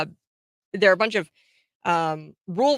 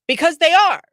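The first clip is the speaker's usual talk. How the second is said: blunt mock reply; 10 semitones higher, 8 dB louder than usual. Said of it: "And he goes".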